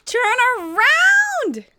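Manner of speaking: high, drawn-out startled cry; startled gasp. Real, not acted. Streamer yelps as her hardware acts up, high startled cry. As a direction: gasp and yell it in real alarm.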